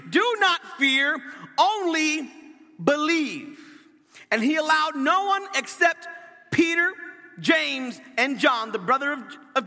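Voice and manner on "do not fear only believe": "Do not fear" pitched high and punched; emphatic. A narrator's reading. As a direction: declare "only believe" high, with a pause before "believe". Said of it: "Jesus says it emphatically".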